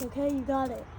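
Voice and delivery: usual speaking voice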